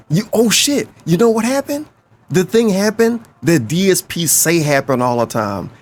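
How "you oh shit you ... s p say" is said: excited. Real, not acted. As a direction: pitch jumps up, eager. Sudden excited realization.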